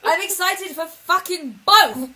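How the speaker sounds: high, loud excited yell; excited shout. Real, not acted. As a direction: yell it out, thrilled. Shouted excitement about both films.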